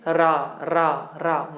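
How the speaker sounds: रा said slowly with a trilled r. Shown as a demonstration. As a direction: roll the r in रा, tongue vibrating. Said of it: "रा बोल करके देखिए".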